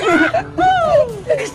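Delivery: very high, light giggle; high squeaky laughter; excited giggle. Acted. Laughing while guessing parcel contents.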